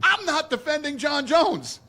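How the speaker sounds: press scrum. Real, not acted. [name] raised voice.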